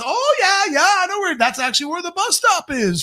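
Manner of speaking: high, eager chatter; mock overfriendly tourist; chatty stranger voice. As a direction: pile on the friendliness. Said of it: "he sounded that way to me in my head".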